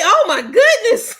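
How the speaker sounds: high, loud laughing relief; laughing oh my goodness; relieved laugh. Real, not acted. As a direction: laugh off the scare. Laughing after scare.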